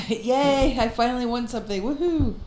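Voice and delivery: high singsong little victory cheer; mock-triumphant woohoo; playful cheering. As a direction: celebrate a tiny win, voice lifted. Mock celebration after being told she wins.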